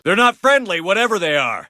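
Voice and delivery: alarmed yell to the team; combat shout. Acted. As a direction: shout the warning to the squad. Warning squad in combat.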